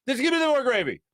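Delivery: retold impatient plea, pitched high; mock exasperation, voice jumping up; exasperated demand. Customer to lady with the gravy, exasperated.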